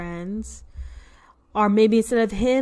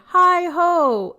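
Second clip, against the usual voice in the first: loud cheery call; 8 semitones higher, 8 dB louder than usual. Acted. Hare calls at the door.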